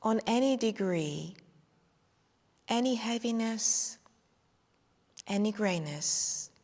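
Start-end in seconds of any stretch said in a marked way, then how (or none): none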